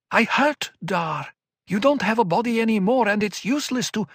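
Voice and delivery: high, urgent, pleading; desperate insistence; anguished young woman. Acted. Murbella in pain.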